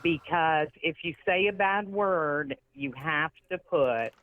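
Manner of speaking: slow, drawn-out mock admonishment; prim mock host. Charity man warning about foul language, slow mock admonishing. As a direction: stretch the warning out like an earnest organiser.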